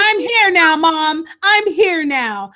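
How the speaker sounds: high, slow mock-tender reassurance; mock caring daughter. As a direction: play a fake doting daughter. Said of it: "she does her".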